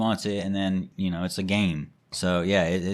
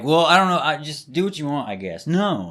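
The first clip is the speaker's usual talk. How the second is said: mimicking sulky daughter; 7 semitones higher than usual. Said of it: "It's like".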